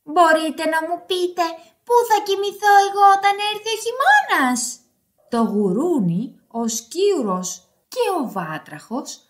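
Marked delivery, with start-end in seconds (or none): 0.0-4.8 raised, hurried hedgehog voice; shouted plea. Acted. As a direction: demand it loudly, climbing higher. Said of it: "φώναξε". (δυνατή, παραπονιάρικη φωνή)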